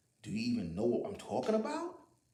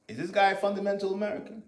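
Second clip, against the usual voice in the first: pitched-up mocking question, a little louder; mocking doubtful commenter; 9 semitones higher, 5 dB louder than usual. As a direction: voice a sneering online commenter. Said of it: "people who'll be like".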